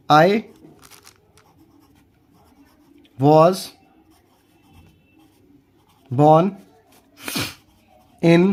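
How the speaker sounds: one word at a time, long silences between. Shown as a demonstration. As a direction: dictate each word separately for learners. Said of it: "तो कैसे बोलेंगे?".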